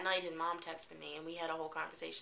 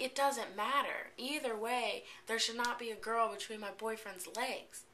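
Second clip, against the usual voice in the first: higher-pitched, drawn-out protest; indignant retort; 5 semitones higher, slower than usual. Retold or imitated. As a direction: retell it as an indignant telling-off. Retold quarrel with boyfriend.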